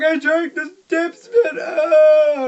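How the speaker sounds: high, loud, slow wail of disgust; panicked cry. Retold or imitated. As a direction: cry out in horror. Brother panics after drinking spit.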